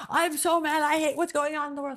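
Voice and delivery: venting helpless fury at the world; mock angry rant. Someone stewing over the news, hammed-up angry rant. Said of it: "It's like".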